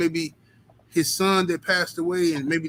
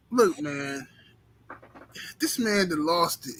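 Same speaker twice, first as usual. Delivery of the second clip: ordinary delivery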